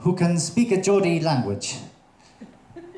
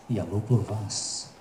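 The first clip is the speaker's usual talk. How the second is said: slower, softer "Я люблю вас"; soft romantic repeat; 6 semitones lower, 7 dB quieter than usual. Shown as a demonstration. Comic to the audience, crooning я люблю вас softly. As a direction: murmur "Я люблю вас" slowly, like a serenade. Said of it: "softer repeat".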